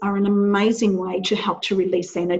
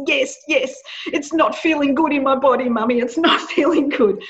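imitating upset daughter; 5 semitones higher than usual.

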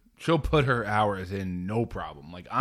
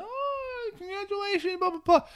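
very high, soft squealed congratulations; mock gushing parent; 3 dB quieter than usual. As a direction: imitate a cooing well-wisher.

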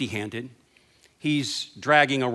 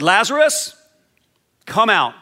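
loud call; 6 semitones higher, 6 dB louder than usual. Acted. Jesus calling Lazarus out of the tomb, loud and high. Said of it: "Jesus spoke loudly these words".

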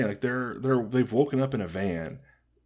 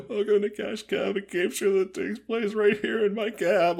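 much higher host voice, a touch of mock crying; cheery host welcome, lightly faked tears; crying game-show host; 11 semitones higher than usual. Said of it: "Like, he's, like, crying".

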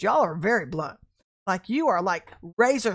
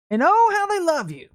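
high, loud swooping gush; effusive exclamation; 8 semitones higher, 9 dB louder than usual. Real, not acted. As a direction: swell up high and loud on the opening word. Exclaims over fickle flattering audience.

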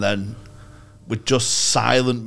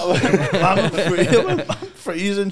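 laughing; 8 semitones higher, 4 dB louder, much slower than usual. Real, not acted. Laughing retelling cold winter.

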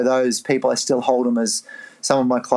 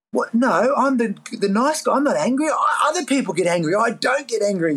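retold protest, pitched up high; indignant denial; 9 semitones higher than usual. To the chiropractor, denying he's angry, indignant and high. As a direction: insist you're the nice guy, mock-offended.

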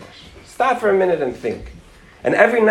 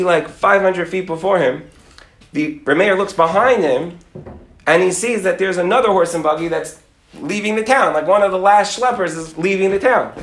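plain reading voice

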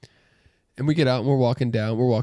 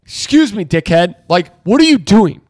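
higher, louder call at a rude stranger; confronting shout; 5 semitones higher, 6 dB louder, slower than usual. Retold or imitated. To the rude guy on the sidewalk, a loud call-out. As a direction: call him out loud on the street. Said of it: "at that point, I'm like".

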